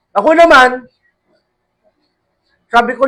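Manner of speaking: ordinary talk, nothing marked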